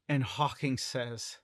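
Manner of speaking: ordinary talk, nothing marked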